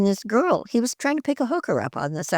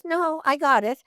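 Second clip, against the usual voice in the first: higher, stubborn imitated insistence; 8 semitones higher than usual. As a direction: mimic a stubborn sound man digging in. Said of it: "and he goes".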